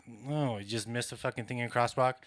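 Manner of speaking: normal talking voice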